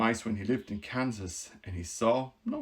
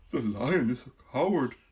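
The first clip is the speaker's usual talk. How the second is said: a touch louder, matter-of-fact; lightly voiced Scarecrow; 5 dB louder than usual. Scarecrow explaining to the queen, lightly voiced. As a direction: state it plainly as the Scarecrow.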